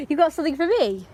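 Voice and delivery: high, loud, excited question; thrilled high-pitched pleading; eager cutesy asking. Real, not acted.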